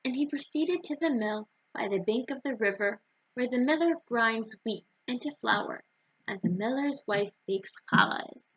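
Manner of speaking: ordinary delivery, nothing marked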